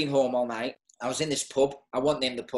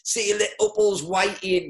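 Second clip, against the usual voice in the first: higher, louder jeering lads; mocking mates; 7 semitones higher, 5 dB louder than usual. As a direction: send him off with a teasing laugh in the voice. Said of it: "everyone started laughing, going".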